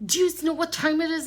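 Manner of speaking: high, loud retold demand; really mad. Woman opening blind on noisy neighbors, retold anger. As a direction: call it out indignantly through the window. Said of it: "really mad".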